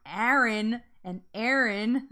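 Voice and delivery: "Aaron and Aaron" drawn out slowly in a New Jersey accent, both vowels the same. Shown as a demonstration. Streamer teasing her own New Jersey accent to chat, slow and laughing. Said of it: "Get my New Jersey on".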